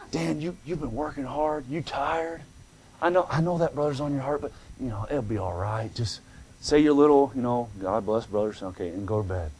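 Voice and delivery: soft, low, soothing murmur; hushed voice of temptation in his ear; coaxing devil. Acted. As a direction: gently lull a tired man into giving up. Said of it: "he knows how to just say it".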